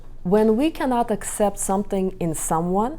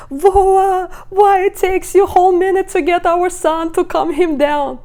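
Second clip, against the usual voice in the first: much higher, louder complaint at husband; exasperated scolding; 11 semitones higher, 7 dB louder than usual. Retold or imitated. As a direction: nag him, loud and high with exasperation. Upset wife scolds slow husband.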